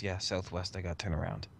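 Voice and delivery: low, soft in-character murmur; hushed reply. Acted. In-character reply while hiding.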